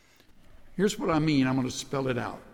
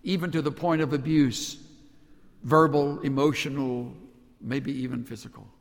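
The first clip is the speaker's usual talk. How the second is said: normal talking voice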